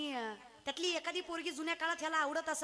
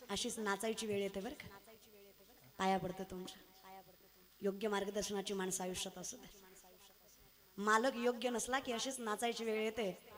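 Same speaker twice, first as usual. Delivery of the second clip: quiet sincere warning; earnest moral; 7 semitones lower, 5 dB quieter than usual. Real, not acted. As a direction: drive the lesson home gently. Preacher drops to moral.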